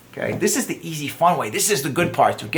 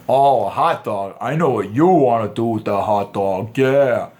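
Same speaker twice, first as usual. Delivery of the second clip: lower, slow, leering delivery; creepy innuendo; 5 semitones lower than usual. Shown as a demonstration. The wrong way to tease her, with lower, slow, leering innuendo. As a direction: lean into the sleazy hint. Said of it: "Don't be creepy about it".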